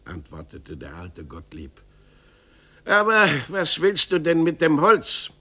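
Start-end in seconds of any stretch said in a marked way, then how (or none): 2.8-5.3 put-on old carpenter huffing with offended pride; indignant carpenter. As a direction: bristle and throw it back at him.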